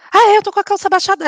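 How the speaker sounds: high, fast, giddy and dazed; dazed pregnant woman answering receptionist, high and quick; giddy realisation. Retold or imitated. Own dazed reply in story.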